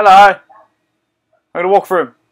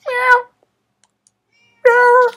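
high meowing cat voice; 4 dB louder than usual. Pretend. Gamer voicing the possessed cat, high meow.

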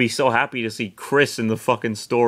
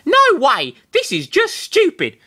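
put-on high girl voice; 4 dB louder than usual. Sarah voiced, angry.

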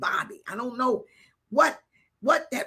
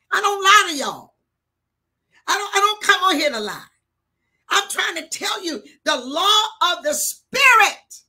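loud, raised preacher's cry; emphatic insistence; 6 semitones higher, 8 dB louder than usual. Real, not acted. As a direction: proclaim it at full force, pitched high. Insists on truth to congregation.

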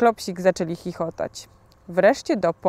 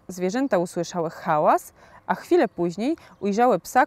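ordinary delivery

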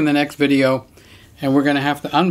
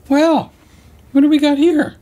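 high sing-song coo to the animal; pet talk; 10 semitones higher, 4 dB louder than usual. Real, not acted. Talking to the visiting pet, high cooing pet voice.

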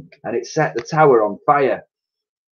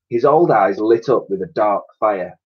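ordinary delivery, nothing marked

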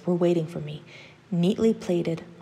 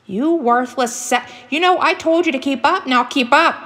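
high, loud, raging scream; furious scream; 9 semitones higher, 9 dB louder than usual. Acted. Hunter father screams at his boy to keep up, furious. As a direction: explode at him at the top of your voice. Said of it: "he screamed at the boy".

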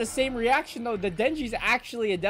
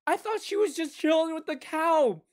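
high, loud appalled yell; outraged at the gore; horrified shout; 10 semitones higher, 4 dB louder than usual. Real, not acted. Anime reactor yelling in horror at the gore.

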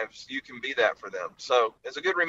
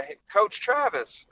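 a restrained call-out, pitched up; mild mock yell; 7 semitones higher than usual. Someone hailing his old coach outside the office, mild mock yell. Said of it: "I kinda yelled".

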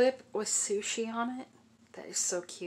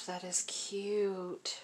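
whisper; 5 dB quieter, much slower than usual. Real, not acted. Admiring painted hair.

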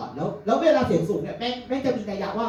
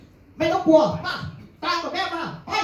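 much higher, slow rallying call; mother rallying; 13 semitones higher than usual. Retold or imitated. Mother tells son to follow her, much higher slow call. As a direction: call him along up high.

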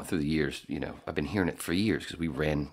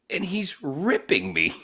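laughing disbelief; 12 semitones higher, slower than usual. Real, not acted. Laughing at being mocked.

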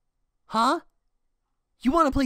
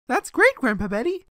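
usual speaking voice

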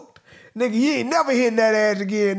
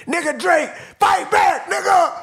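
hyped shout; 5 semitones higher than usual. Real, not acted. Vlogger urging [name] to answer the diss, shouting. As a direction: egg him on like a crowd.

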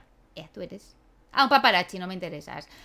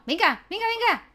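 higher, louder venga venga; cheering; 8 semitones higher, 4 dB louder than usual. Real, not acted. Player cheering her character during the race.

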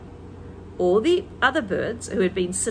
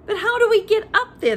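much higher, loud squeaky plea; little monkey voice; 12 semitones higher, 7 dB louder than usual. A narrator's reading. Monkeys asking Mugglewump how to reach, high and pleading. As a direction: ask up like a small monkey. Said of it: "they asked".

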